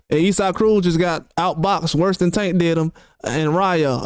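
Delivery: normal talking voice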